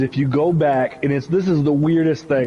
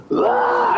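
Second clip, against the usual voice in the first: high-pitched cry of joy; a fan's high whoop as the touchdown lands; high-pitched retold whoop of joy; excited yell. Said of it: "I was freaking out".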